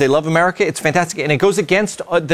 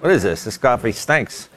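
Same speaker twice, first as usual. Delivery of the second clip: complaining; 5 semitones lower, 4 dB quieter than usual. Retold or imitated. Host mimics own complaints about coffee in Europe, grumbling. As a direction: imitate a dissatisfied tourist, dropping lower and softer. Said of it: "I complain".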